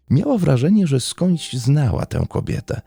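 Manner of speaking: plain reading voice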